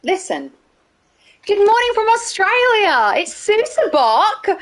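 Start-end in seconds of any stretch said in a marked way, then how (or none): 1.5-4.5 excited greeting. Real, not acted. Host hailing Australian viewers on a stream, excited. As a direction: greet them in a high, excited sing-out.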